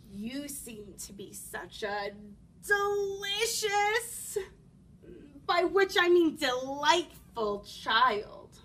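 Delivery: slow, drawn-out wolf, relishing DELICIOUS; sly hungry wolf. Acted. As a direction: savour the word, then cover the slip.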